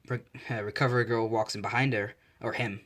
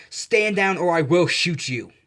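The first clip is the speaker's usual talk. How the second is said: a cop shouting a threat; warning shout; 6 semitones higher, 8 dB louder than usual. A narrator's reading. Cop aiming a gun at All Might, a loud warning shout. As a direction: bark the warning higher and louder.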